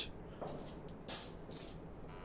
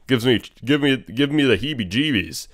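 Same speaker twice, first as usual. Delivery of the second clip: usual speaking voice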